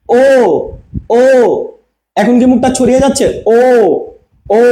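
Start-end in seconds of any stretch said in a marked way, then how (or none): 0.1-1.2 rounded drawn-out ও, lips round; demo vowel o. Shown as a demonstration. Teacher showing students the rounded ও. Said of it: "দেখেন". 3.6-4.6 ও held with round lips; demo vowel o. Shown as a demonstration. Teacher showing the round mouth for ও. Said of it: "গোল হচ্ছে না".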